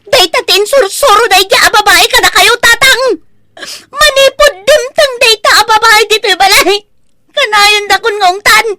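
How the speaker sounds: high, loud, tearful rant at the father; furious crying rant; angry tearful outburst. Acted. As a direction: lash out, voice near tears. Daughter erupts against father's woman.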